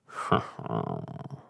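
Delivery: very low villain's laugh; dark storybook chuckle; grim laugh. A narrator's reading. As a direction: laugh low and grimly, like a plotting baddie. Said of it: "laughed one of them grimly".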